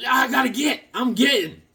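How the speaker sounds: high, comic runaway yell; mock panicked shout. Cartoon runner racing for the getaway car, high mock shouting.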